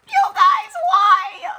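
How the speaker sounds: high, loud wail of delight; overwhelmed squeal. Real, not acted. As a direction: wail it to the viewers, overcome. Overwhelmed by the kind note.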